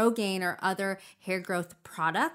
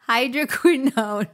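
emphatic retry; 4 semitones higher, 8 dB louder than usual. Shown as a demonstration. Retrying under correction.

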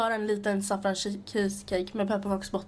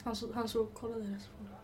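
a much softer secret aside; hushed whisper; 10 dB quieter than usual. Real, not acted. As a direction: murmur it so the man nearby can't hear. Whispering about a man nearby.

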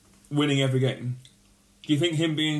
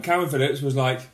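ordinary delivery

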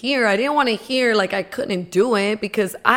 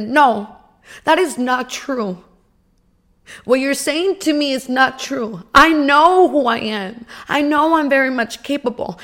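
upset defiance; 5 semitones higher, 6 dB louder than usual. Retold or imitated. Karen to the counselor, louder and furious. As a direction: slam it down, you won't be told no. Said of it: "I was so upset".

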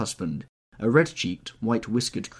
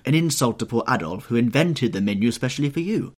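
raised, emphatic hostess's scolding; 4 semitones higher, 6 dB louder than usual. A narrator's reading. Lady scolding her guest for not eating, indignant. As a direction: chide a guest with mock offence. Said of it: "kept scolding him for what she called".

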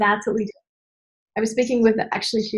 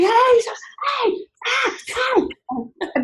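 much higher yapping hey; barking dog; 13 semitones higher, much slower than usual. Retold or imitated. The dog alerting at the door, high yapping. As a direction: yap like an alert little dog. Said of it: "They're just like".

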